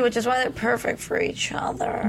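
soft unvoiced gushing whisper. Real, not acted. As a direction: breathe it like a sweet secret. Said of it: "I get the baby voice on when I talk about them".

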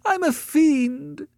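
very high, drawn-out wail; desperate sobbing. A narrator's reading. Annette keeps abusing herself before Beverly, sobbing. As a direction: wail the self-reproach in mock sobs. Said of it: "sobbed desperately".

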